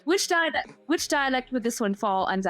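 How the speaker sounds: put-on Queen's English accent; American trying very proper British English. Shown as a demonstration. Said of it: "I feel like it's very proper".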